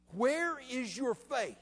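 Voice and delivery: high, slow, drawn-out demand; stern rebuke. Acted. Jesus turns to the frightened apostles, stern rebuke.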